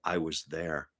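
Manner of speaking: low, soft, bone-dry delivery; deadpan understatement. Retold or imitated. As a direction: drop it flat and quiet. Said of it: "Stan just deadpans and goes".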